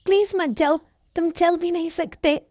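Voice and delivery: high, anxious begging; wife begging her injured husband not to go; pleading wife. Acted. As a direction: beg him to stay, worried.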